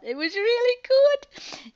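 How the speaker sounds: very high, loud, drawn-out mock child squeal; imitating gleeful confession. Aunt imitating great niece relishing the biscuits, gleeful high squeal. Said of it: "I just love how she's just gone".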